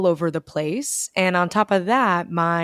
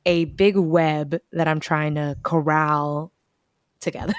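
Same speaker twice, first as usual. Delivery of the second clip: normal talking voice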